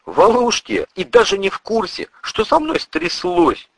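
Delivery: high, anxious denial; flustered young hero protesting. Acted. Narrator assures the priest his friends are safe, flustered and high. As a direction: protest earnestly, pitch climbing as you go.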